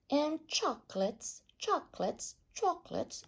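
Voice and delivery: high echoing repeats; cave echo. A narrator's reading. Cave echo answering the greedy rat.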